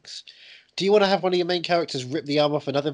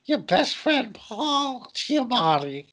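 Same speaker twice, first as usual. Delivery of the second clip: silly put-on voice; 4 semitones higher than usual. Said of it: "your bloody voice".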